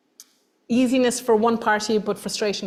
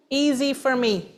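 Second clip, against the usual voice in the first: smug; 4 semitones higher, slower than usual. Pretend. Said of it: "'cause it's easy for me".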